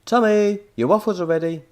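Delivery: loud call up the stairs; calling upstairs. A narrator's reading. Mother calling up the stairs to Tommy, loud. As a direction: call him down to eat. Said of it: "mother called up the stairs".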